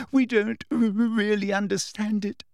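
high, snivelling stammer; tearful. A narrator's reading. Hermione feigning tears for Umbridge, high tearful stammer. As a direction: stutter as if crying. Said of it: "sniffing loudly".

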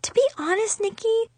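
high, small, hurt voice; wounded and high-pitched; sniffling. A narrator's reading. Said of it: "Chloe sniffed".

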